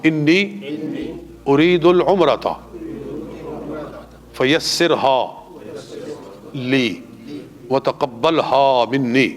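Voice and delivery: slow drawn-out recitation for students to repeat. Shown as a demonstration. Teacher leading students in reciting the intention. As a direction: say each word slowly so they can follow. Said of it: "میرے ساتھ مل کے کہہ لیں".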